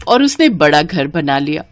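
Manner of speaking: plain reading voice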